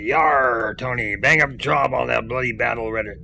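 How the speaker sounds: a light pirate growl on "Yar". Retold or imitated. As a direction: read it like a hammy sea pirate. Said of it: "talks like a pirate to say".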